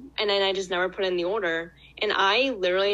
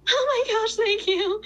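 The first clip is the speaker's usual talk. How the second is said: much higher, louder relieved squeal; relieved thanks; 4 dB louder than usual. Retold or imitated. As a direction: gush with relief and gratitude. Relieved hostess thanks kind driver.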